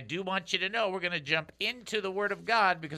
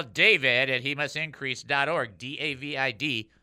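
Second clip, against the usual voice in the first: ordinary talk, nothing marked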